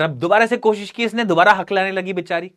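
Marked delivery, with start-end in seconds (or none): none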